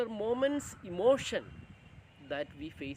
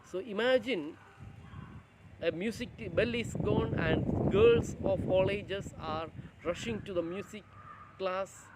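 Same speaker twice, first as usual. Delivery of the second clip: ordinary talk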